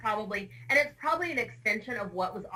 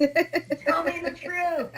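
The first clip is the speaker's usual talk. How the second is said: laughing; 9 semitones higher, 4 dB louder, much slower than usual. Real, not acted. Laughing disbelief at ad.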